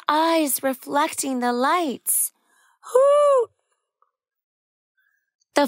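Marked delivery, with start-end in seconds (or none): 2.9-3.5 high, loud owl hoot. Acted. Owl call in story.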